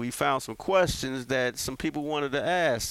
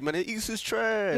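mock dismissive quote, pitched up; imitating cohost; 9 semitones higher than usual. Host mocking cohost's old take, dismissive. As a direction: mimic a friend trashing the East. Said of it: "usually you be like".

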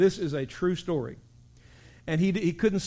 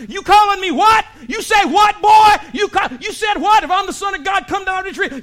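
furious shouting, much higher and louder; angry mock shout; 12 semitones higher, 12 dB louder than usual. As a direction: yell like an offended brawler. Mocking crowd at the cross.

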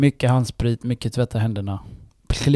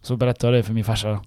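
ordinary delivery

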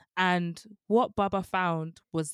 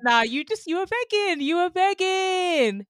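high, loud teasing; 13 semitones higher, 10 dB louder than usual. Real, not acted. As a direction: tease her loudly. Teasing friend loudly.

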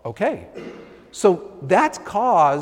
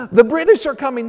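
loud, high alarm cry, like a rider warning the town; Paul Revere's alarm call; 7 semitones higher, 11 dB louder, faster than usual. Retold or imitated. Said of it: "riding through New England going".

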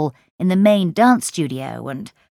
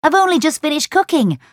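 calling out; 9 semitones higher than usual. Acted. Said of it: "she called".

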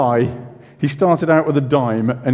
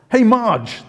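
raised calling voice; 9 semitones higher than usual. Retold or imitated. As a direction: call out to the wife. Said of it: "he shouted to his wife".

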